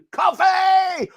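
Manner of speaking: high, loud, drawn-out cry; long high holler, half laughing; playful shout. Pretend. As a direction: holler it like a cheer.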